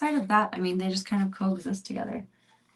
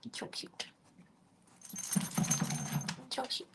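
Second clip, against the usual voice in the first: whisper; 4 semitones higher, 10 dB quieter, much slower than usual. Real, not acted. Owner cooing at her dog, whispered slowly. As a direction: coo it under your breath. Whispered baby talk to pet.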